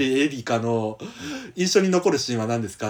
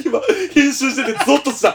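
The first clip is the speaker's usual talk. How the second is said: laughing; 12 semitones higher, 6 dB louder than usual. Real, not acted. Laughing at the edit.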